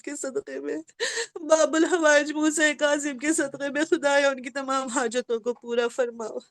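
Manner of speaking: low, soft, pleading supplication; closing dua of the majlis; prayer. Real, not acted. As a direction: pray quietly for the congregation.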